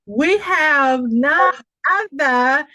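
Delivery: loud, high, slow stage introduction; host calling the next honoree to the stage; hype announcer. Real, not acted. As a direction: build up the name like a grand reveal.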